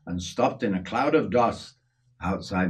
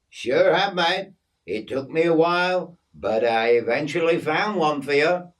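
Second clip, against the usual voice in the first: higher, upbeat voice of a mate with good news; lively story-character voice; cheery Aussie mate; 6 semitones higher, 4 dB louder than usual. Acted. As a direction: play the chummy Aussie bearing good news.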